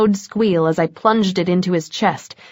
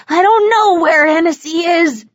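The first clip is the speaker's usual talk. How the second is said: higher, louder panicked shout; 9 semitones higher, 4 dB louder than usual. A narrator's reading. Captured vampire shouting at Bones, panicked. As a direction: blurt it out in panic. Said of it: "he shouted".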